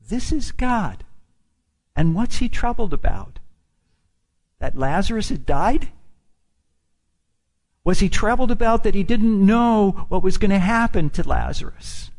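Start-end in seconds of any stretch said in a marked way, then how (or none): none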